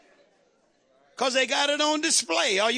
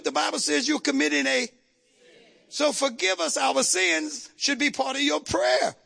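ordinary talk

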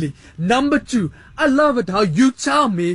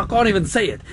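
ordinary talk, nothing marked

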